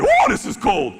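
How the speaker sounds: playful seal complaining about the cold; mock shiver. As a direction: squeal it like the water is freezing. Seals hitting cold water.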